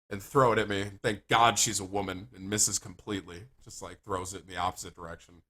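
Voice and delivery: ordinary talk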